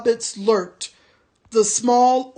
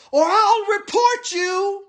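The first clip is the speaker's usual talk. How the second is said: orc shout; 11 semitones higher than usual. A narrator's reading. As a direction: shout the threat after him. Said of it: "shouted the soldier".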